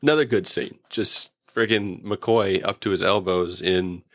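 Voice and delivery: normal talking voice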